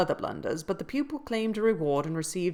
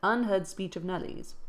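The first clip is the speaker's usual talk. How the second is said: ordinary delivery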